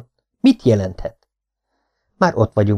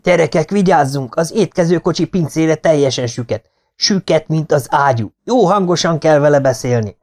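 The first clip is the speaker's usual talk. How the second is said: excited; 6 semitones higher than usual. Acted. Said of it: "Izgatott, liheg".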